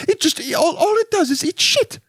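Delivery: squeaky-high posh British falsetto; hammed-up British snob, aghast; posh British lady. Corseted lady sneering at bottom feeders, very high posh British. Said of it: "she talks high like that".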